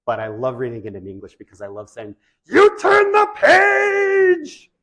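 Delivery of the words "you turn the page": very high, loud, drawn-out puppet shriek; high-pitched monster wail, slow and huge; Grover. Acted. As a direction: holler it in a high, strained Muppet voice, stretching every word. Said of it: "I love that Grover voice".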